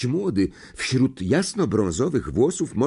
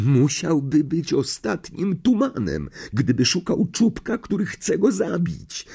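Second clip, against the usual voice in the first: shaken; 9 semitones higher than usual. A narrator's reading. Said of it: "roztrzęsionym głosem".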